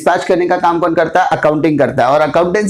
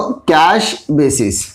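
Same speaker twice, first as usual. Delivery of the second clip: slow dictation; 2 semitones lower, much slower than usual. Shown as a demonstration. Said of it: "क्या होता है वो?".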